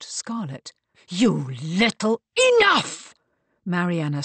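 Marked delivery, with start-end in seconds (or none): 1.1-2.2 slow, loud, furious start of an insult; seething anger. A narrator's reading. Marcus rounds on Kate after her question, seething. 2.6-3.1 high, loud cut-off; commanding shout. A narrator's reading. Mariana silences the quarrelling family, shouting commandingly.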